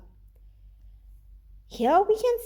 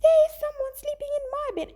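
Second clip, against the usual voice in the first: much higher, fast squeaky cub voice; Baby Bear; 14 semitones higher, 3 dB louder, faster than usual. Acted. Teacher voicing Baby Bear for her students, high and fast. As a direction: blurt it out like a frightened little cub. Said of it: "And Baby Bear says".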